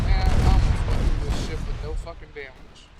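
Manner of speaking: loud exasperated rant; frustrated. Real, not acted. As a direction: vent the frustration loudly. Frustrated rant after torpedo.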